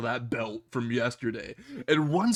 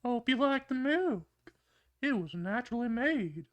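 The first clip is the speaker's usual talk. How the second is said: high, soft creepy voice with a hum at the end; quiet, high-pitched sinister creep; sinister Herbert; 9 semitones higher, 6 dB quieter than usual. A narrator's reading. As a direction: creep them out in a high, hushed voice. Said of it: "say the most sinister thing".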